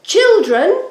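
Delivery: loud, high call across the garden. A narrator's reading. God calling to Adam and Eve, loud high call. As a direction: call the children from afar. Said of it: "he called to them".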